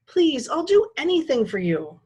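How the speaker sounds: begging. Acted. Jed begs Lyle.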